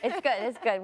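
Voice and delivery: bright laugh spilling into the words; giggling at her co-guest's joke. Real, not acted. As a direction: laugh it off, amused. Laughs at Duncan's favorite part.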